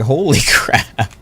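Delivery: ordinary delivery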